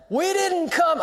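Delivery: angry shout at the movie previews; loud outburst. Retold or imitated. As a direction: yell it out in the dark theater. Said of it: "I said with a loud voice in the theater".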